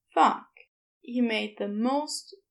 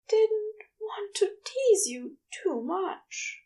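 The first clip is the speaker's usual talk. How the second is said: breathy whisper, higher and slow; hushed, intimate whisper; soft whisper; 9 semitones higher than usual. Acted. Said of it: "Aziraphale said softly".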